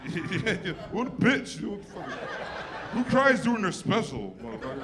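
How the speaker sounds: high, loud, laughing self-mockery; mocking himself. Comic mocking himself for crying on stage, high and loud. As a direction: jeer at your own tears. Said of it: "like a bag".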